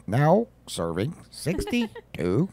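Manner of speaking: high, drawn-out announcement voice; automated order announcer. Retold or imitated. Mimicking the Wendy's conveyor calling numbers, automated announcer voice. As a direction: slowly call it out like an order machine. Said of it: "and it goes".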